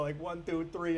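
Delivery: ordinary delivery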